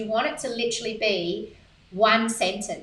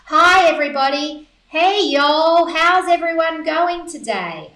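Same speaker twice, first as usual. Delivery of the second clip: loud, higher, breezy American greeting to a crowd; American accent; 6 semitones higher, 7 dB louder than usual. Pretend. Coach mocks a generic mass email greeting in an American accent. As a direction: ham up a cheery American hello, louder and higher. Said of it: "I don't know why I became American then".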